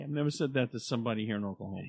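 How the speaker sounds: ordinary talk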